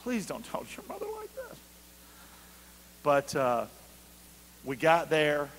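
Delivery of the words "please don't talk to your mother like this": scolding aside. Retold or imitated. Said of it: "This is horrible".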